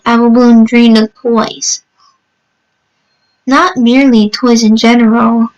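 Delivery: plain reading voice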